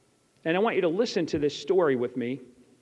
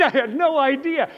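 laughing; 6 semitones higher, 6 dB louder than usual. Real, not acted. Laughing at his own blunder.

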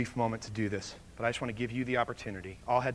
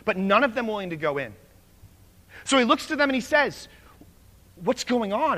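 loud, high, driving preacher's storytelling; fired-up preaching; 8 semitones higher, 11 dB louder than usual. Acted. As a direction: preach the story with full force, pushing every line. Preaching story at full force.

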